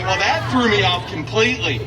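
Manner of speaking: ordinary talk, nothing marked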